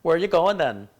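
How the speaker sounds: loud, high Welsh lilt; rise, dip and rise in a Welsh accent; sing-songy Welsh. Shown as a demonstration. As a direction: melodic attempt at a Welsh accent. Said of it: "It's kinda sing-songy".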